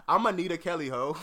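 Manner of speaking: loud laugh into words; laughing hard. Real, not acted. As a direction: laugh out the name. Laughing at silly name joke.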